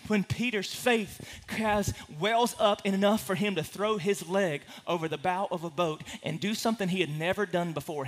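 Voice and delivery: ordinary delivery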